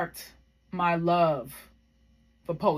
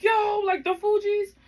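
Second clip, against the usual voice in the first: much higher, hyped imitation of the older siblings; excited siblings; 13 semitones higher, 3 dB louder, slower than usual. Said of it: "they were like".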